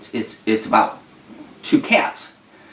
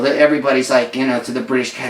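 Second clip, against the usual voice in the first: usual speaking voice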